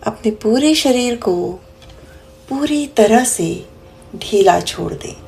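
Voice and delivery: slow, soothing meditation guide with long pauses; guided meditation. Acted. Meditation teacher guiding listeners to close their eyes and breathe, slow guided meditation. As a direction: lead the breath gently, drawing out the words and softening the counts.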